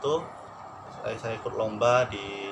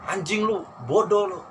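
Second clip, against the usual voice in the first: higher, louder mock scolding; retold scolding; 8 semitones higher, 4 dB louder than usual. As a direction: imitate the director's insults. (menirukan sutradara memaki)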